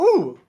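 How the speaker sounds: high, loud delighted "ooh"; excited "ooh". Retold or imitated. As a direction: coo at a shiny new toy. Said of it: "I go".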